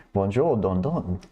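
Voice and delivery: slow, hammed-up French bonjour; mock French sing-song, played for laughs; jokey put-on French accent for chat; fake French accent. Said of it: "Let's just talk like that".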